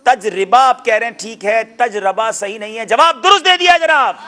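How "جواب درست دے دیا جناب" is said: loud, high-pitched host's cry; rousing game-show call; triumphant announcement. Real, not acted. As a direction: proclaim the right answer to the audience. Quiz host announcing correct answer.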